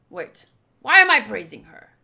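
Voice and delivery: sudden loud "wait"; startled self-correction. Acted. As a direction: snap out of it, loud and indignant. Catches himself praising rival.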